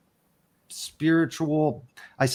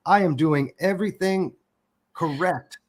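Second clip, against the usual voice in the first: higher, half-hearted furious rant; venting at God in retelling, pitched up; mock yell at God; 4 semitones higher than usual. Man retelling his rant at God, mild mock anger. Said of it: "I yelled out at God".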